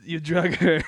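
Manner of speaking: hammed-up Italian-American mobster accent; badly faked mafia-boss accent, mock heartbroken; terrible mobster accent. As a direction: play a wounded don in a bad Italian-American accent. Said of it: "that was a terrible accent".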